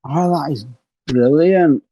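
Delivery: normal talking voice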